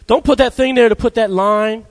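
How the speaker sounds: high, whiny clay complaining to the potter. Retold or imitated. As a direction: protest like stubborn clay being squeezed. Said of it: "you're arguing with the potter, saying".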